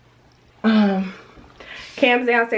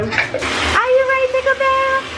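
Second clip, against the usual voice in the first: very high, squeaky cooing questions. Real, not acted. Mom asking son about his bath, high baby talk.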